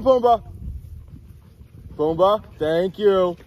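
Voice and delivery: high, loud calling the dogs; calling from far away. Real, not acted. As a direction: call the dogs from across the yard.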